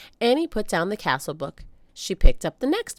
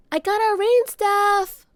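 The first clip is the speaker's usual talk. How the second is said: put-on high, loud little-girl call; child calling, slow and loud; 7 dB louder, slower than usual. A narrator's reading. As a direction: call out to her brother from upstairs. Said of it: "called Annie".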